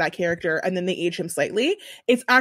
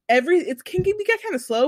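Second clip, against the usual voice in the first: ordinary talk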